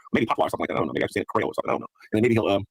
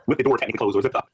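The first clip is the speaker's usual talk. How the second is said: ordinary talk, nothing marked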